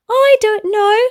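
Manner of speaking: put-on high offhand child. Boy dodging a question, lightly childlike. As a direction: toss it off like a kid.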